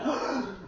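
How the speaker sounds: a sharp, quiet, high intake of breath; shocked gasp. Acted. The soldier gasps when the horse speaks. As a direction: gasp in disbelief.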